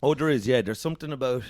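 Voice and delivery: usual speaking voice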